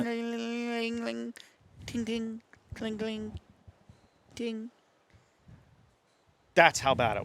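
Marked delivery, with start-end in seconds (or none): 0.0-4.7 high, soft ting-cling of boots on crusty snow; crunchy ice sound. Retold or imitated. Hunter mimics crusty snow underfoot with high soft tings. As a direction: tinkle it out high and quiet like breaking ice crust. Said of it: "I mean shiny crusty".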